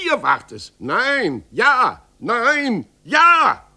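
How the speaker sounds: put-on childish yes-no fight rising to a shout; quarrel building to shouting.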